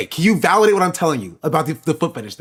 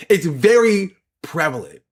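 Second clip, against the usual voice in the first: ordinary talk, nothing marked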